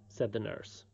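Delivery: ordinary delivery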